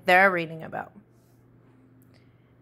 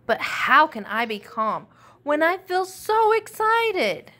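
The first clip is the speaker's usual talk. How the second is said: put-on higher and louder, eager whiny kid; too wound up to sit still; excited protest; 7 semitones higher, 6 dB louder than usual.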